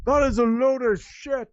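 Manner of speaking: high, loud, furious objection; angry outburst. Acted. Villager shouting down the accusation, furious. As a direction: spit it out, indignant and loud.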